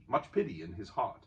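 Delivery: usual speaking voice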